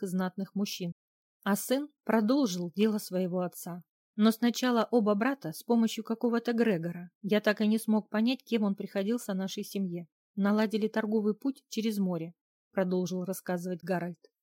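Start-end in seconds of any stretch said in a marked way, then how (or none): none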